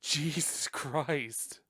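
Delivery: slow, drawn-out shocked gasp of words; stunned disbelief. Retold or imitated. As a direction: say it like you can't believe what you just saw. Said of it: "I was like".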